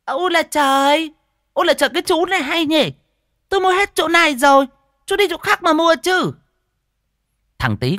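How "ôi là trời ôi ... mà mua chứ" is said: very high, loud indignant shrew; tart, shrewish. A narrator's reading. Fat woman scolds a rival fish buyer, tart and shrewish. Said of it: "mụ đanh đảnh".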